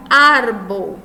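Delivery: "Árbol" with a loud, punched ÁR; stress on first syllable. Shown as a demonstration. Teacher showing a llana word, "Árbol" stressed on ÁR. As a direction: hit the opening syllable of "Árbol" hard. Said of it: "é a sílaba mais forte".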